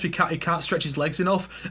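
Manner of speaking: usual speaking voice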